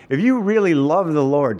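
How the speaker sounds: ordinary talk, nothing marked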